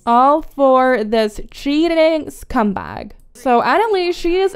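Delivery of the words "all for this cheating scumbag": normal talking voice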